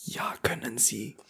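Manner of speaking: soft ASMR whisper answer. Pretend. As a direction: whisper it close to the mic.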